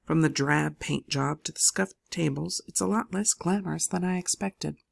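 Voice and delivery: usual speaking voice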